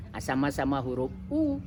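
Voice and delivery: ordinary talk, nothing marked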